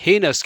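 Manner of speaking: हेनस as HAY-nas, two syllables; silent i. Shown as a demonstration. Teacher showing correct reading, हेनस as HAY-nas with silent i. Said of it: "तो कैसे पढ़ेंगे".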